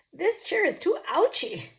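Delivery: high, loud ouchy complaint; pained bear. Acted. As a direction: squeal it like the chair hurts. Bear testing chairs.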